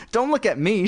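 teasing. A narrator's reading. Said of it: "she teased".

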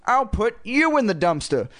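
high, squeaky puppet threat; squeaky ventriloquist's comeback; Slappy's dummy voice. A narrator's reading. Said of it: "she made Slappy say".

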